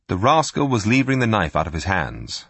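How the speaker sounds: ordinary delivery, nothing marked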